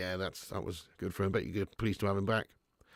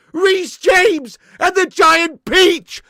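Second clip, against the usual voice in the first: high, slow, shouted commentator call; hammed-up football commentary roar; mock goal commentary; 12 dB louder, much slower than usual. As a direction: bellow it like a commentator on a screamer. Repeats pun, extends it.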